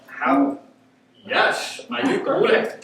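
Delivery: high, disbelieving answer; stupefied. Acted. Groom asked to agree again at his wedding, stupefied. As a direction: answer as if baffled at being asked twice. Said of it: "I look at him like stupefied".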